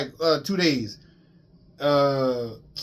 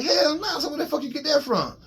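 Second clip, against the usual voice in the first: high, laughing denial; laughing disbelief; 10 semitones higher than usual. Real, not acted.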